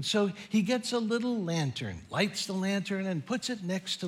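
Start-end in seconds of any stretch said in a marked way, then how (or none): none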